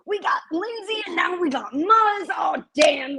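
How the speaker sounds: 3 hype it up like a crowd cheer; 1 mock excited shout. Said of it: "I'm like".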